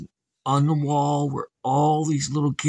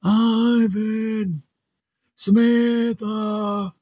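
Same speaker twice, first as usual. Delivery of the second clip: slow drawn-out distant call; calling from outside; 7 semitones higher, much slower than usual. Acted. Dad calling his children from outside. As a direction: call out across the yard. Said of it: "somebody outside saying".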